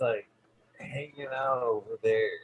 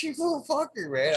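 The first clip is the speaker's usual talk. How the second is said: high mock insult; 14 semitones higher than usual. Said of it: "I'm like".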